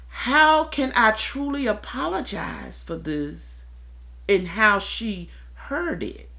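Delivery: usual speaking voice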